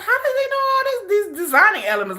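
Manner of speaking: high, half-laughing, half-crying disbelief; amazed laughing squeal. Retold or imitated. Retelling her amazement at Sugar's skills, squealing with laughter. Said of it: "I was like".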